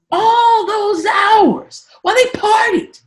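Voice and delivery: slow shout of outrage; outraged slow shout. Real, not acted. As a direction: drag it out loud, outraged. Outraged about Whitney.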